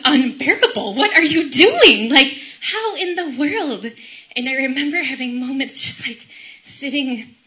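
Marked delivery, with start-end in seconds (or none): 0.0-3.8 retold protest, loud and high; re-enacted exasperation at God; exasperated outcry. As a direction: let the complaint climb into a drawn-out loud plea. Re-enacts complaining to God in pain.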